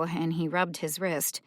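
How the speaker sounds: ordinary delivery, nothing marked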